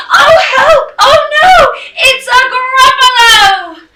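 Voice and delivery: high, loud panicked shriek; squealing alarm at a monster; frightened cry. Acted. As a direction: cry out for help in storybook terror. Mouse's terror at seeing Gruffalo.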